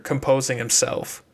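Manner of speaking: ordinary delivery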